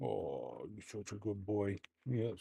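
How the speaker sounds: soft, low, hushed praise for a dog; tender praise. Real, not acted. Owner praising the dog, soft and hushed. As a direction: coo it gently, almost breathless.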